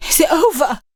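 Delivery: high, weak croaking question; barely able to get the words out; croaked. Acted. Exhausted Rani asking if it is over: weak croak. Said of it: "she croaked".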